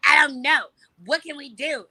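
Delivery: high, loud, petulant whine; bratty whine. Retold or imitated. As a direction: whine helplessly like a spoiled kid. Retells her bratty indecision to friends.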